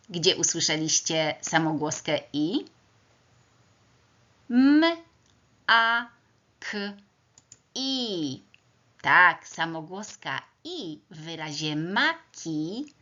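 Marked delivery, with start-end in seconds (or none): 4.5-8.4 "M, a, k, i" as four separate sounds, high-pitched; sounded out phonemes. Shown as a demonstration. Teacher sounding out a word for pupils, phoneme by phoneme. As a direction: isolate each sound of "M, a, k, i" with pauses. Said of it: "Teraz głoskujemy wyraz".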